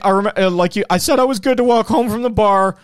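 loud, high mock goat bro. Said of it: "be like".

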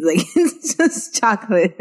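amused, laughing through the words. Real, not acted. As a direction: crack up while saying it. Cracking up at the recited words.

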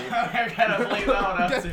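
laughing loudly; high laughing words. Real, not acted.

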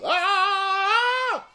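high sustained sung scream. Retold or imitated. Coward fleeing, high sung scream. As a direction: hold the scream like a note. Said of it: "you see how a man sing right on time".

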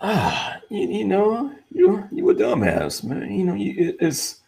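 a touch of a friend calling him a fool; mock friend scolding. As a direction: scold him like a buddy would, half laughing. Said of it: "what's the first thing that's popping".

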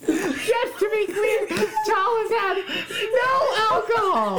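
very high, slow, wailing whale call. Acted. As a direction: draw every word out in a high wail. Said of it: "thank yous in silly voices".

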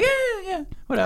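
high, far-off brush-off, mocking her shrug; nonchalant mimicry. Friend mocks her nonchalance about grandma's death, high and dismissive. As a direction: toss it off as if it barely matters. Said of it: "why are you so nonchalant about it".

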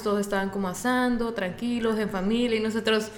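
ordinary delivery

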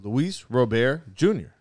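slow "Robert" as roh-BAIR; French-style pronunciation. Shown as a demonstration. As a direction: spell out "Robert" by ear, slowly, roh-BAIR. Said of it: "It's pronounced Robert".

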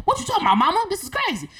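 high-pitched incredulous question to mom; mock disbelief. Daughter questioning Mama about the tribe, mock disbelief. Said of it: "I was like".

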